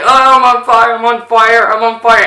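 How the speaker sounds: high, loud cries of alarm; mock panic. Retelling Johnny catching fire on the bike, high mock-panicked yelling. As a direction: imitate someone frantically yelling that they're burning.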